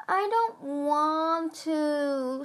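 put-on slow, high whine like a scared child; whining. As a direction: drag it out in a whimper. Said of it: "Squid whined".

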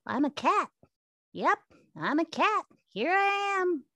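very high, put-on cat voice; Felix the cat. Owner voicing pet cat asking for a treat, high cat voice. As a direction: squeak it out high, as a talking cat. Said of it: "that's basically my Felix voice".

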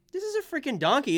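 high, exasperated "this is a freaking donkey"; mock disbelief. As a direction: act baffled at the fake zebra.

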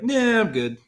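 high, loud dismissive cartoon voice; put-on high voice, casually declining; beard refusing to grow. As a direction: play a beard cheerfully opting out. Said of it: "my beard goes out so far".

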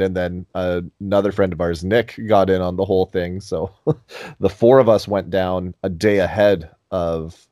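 ordinary delivery

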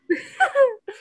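a sudden high cackle; high burst of laughter. Real, not acted. Laughing at her own true-love joke, high cackle. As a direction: laugh out loud, high and bright.

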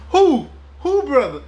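high, loud incredulous questions; indignant disbelief. Retold or imitated. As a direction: challenge it with pitch shooting up. Retells challenging the visitor's claim.

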